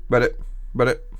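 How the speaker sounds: low, soft frog ribbit; croaking frog. Acted. Frog looks at the offered books, croaking. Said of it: "The frog looks at the books and goes".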